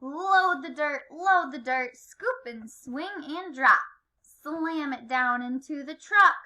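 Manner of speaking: lively picture-book read-aloud with acted sound words; reading to children. A narrator's reading. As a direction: read rhythmically, stretching key words and playing up the noises.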